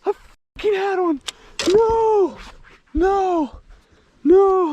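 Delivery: high, strangled cry over a lost fish; whiny, near-tears no-no-no; anguished wail. Real, not acted. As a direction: wail like the fish just broke your heart. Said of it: "I'm gonna cry".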